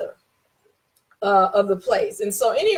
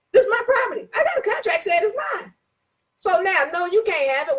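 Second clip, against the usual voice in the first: raised pitch, firm and emphatic; defiant high insistence; 8 semitones higher than usual. Real, not acted. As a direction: claim it as yours, high and strong. Defiant at the police scene.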